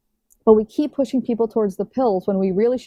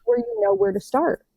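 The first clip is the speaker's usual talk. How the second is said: raised coaching voice; 11 semitones higher than usual. Retold or imitated. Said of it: "I'm like".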